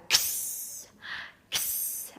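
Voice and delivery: "K" run straight into hissed "S"; quick voiceless "ks", no gap inside. Shown as a demonstration. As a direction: join "K" and "S" into one sound. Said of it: "now we're gonna do it faster".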